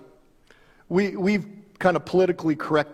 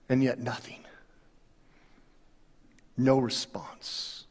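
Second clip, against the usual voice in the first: hushed whisper; 8 semitones lower, 9 dB quieter than usual. Acted. Preacher tells the congregation no god answered, in a hushed whisper. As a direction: let it fall to a whisper of nothing.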